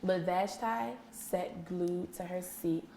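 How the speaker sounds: slow, soft read-aloud narration; teacher slips into a quiet storytime voice; story read aloud. Acted. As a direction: read it to the class, hushed and slow.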